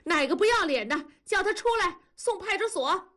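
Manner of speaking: fast, high-pitched berating; scolding. A narrator's reading. Wenli joins in berating the culprit, fast high scolding. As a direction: rattle off the abuse, pitch climbing. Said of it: "文丽跟着数了".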